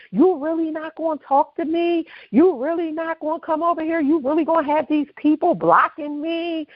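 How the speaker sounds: high, insistent retold hollering without volume; mock yell. Pregnant woman hollers at her friend past a man, mock yell. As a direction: imitate calling over someone's shoulder. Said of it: "She's screaming over top of this man's shoulder".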